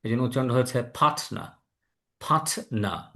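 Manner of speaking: "ফাটনা" for partner with no r; British pronunciation. Shown as a demonstration. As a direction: demo "ফাটনা" dropping the r. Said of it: "রটাকে ওরা বাদ দিয়েছে".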